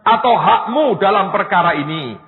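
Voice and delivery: loud, forceful Bible reading; scripture reading. A narrator's reading. Preacher reads Peter's rebuke to congregation, loud scripture reading. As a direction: proclaim the passage with weight.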